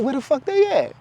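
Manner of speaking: high-pitched, baffled exclamation; acted indignant question; exasperated disbelief. Acted exasperation at missing followers.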